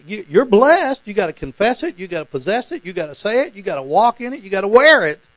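high, rhythmic preacher's exhortation; mock insistent preaching. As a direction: hammer each phrase like a zealous preacher. Mimics insistent positive-confession preaching.

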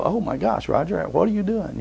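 soft muttered self-rebuke; mild mock self-scolding; self-scolding aside. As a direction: scold yourself under your breath. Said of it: "I slip and I go".

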